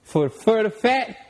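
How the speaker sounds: high, loud boastful hype; hyped bragging. Real, not acted. Bragging to the host about his playing days, hyped and high. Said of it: "hyped boasting about hoop days".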